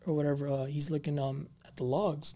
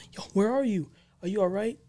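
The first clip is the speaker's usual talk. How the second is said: louder, higher worried call down the line; alarmed call; 7 semitones higher, 5 dB louder, faster than usual. Retold or imitated. Mike shouting to his friend on the phone, alarmed. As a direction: shout to a friend who may be in danger.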